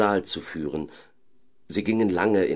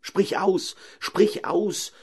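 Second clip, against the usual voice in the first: much higher, insistent shout; urgent cry; 13 semitones higher, much slower than usual. Acted. Caliph urges the owl to name her condition, high insistent shout. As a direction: shout at her to speak, impatient. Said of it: "schrie Chasid".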